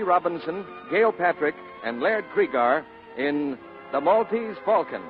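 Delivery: ordinary delivery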